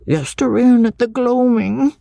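put-on high-pitched village boy with dialect; little boy Jacob. A narrator's reading. Schoolboy answers the lady about the ghost, little village boy. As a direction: answer as a small country lad, high. Child witness voiced in dialect.

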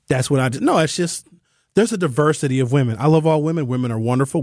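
usual speaking voice

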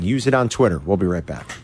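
ordinary talk, nothing marked

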